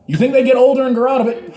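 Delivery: ordinary delivery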